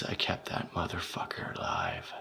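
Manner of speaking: low, soft whispered boast; sadistic whisper. Acted. Boasting of keeping him alive, whispered. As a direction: whisper it with cruel pride.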